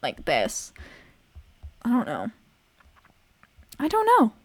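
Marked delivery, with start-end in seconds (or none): 0.0-0.7 lower, slower voice; lowered voice demo. Shown as a demonstration. Showing viewers how the voice sounds now, lowered voice. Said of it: "sounds, like, more like this". 3.8-4.3 much higher, louder swooping pitch. Shown as a demonstration. Testing the voice for viewers, high-pitched.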